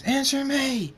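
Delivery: high, loud, desperate scream; scream, demanding a reply. A narrator's reading. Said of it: "Draconic screams".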